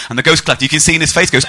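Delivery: usual speaking voice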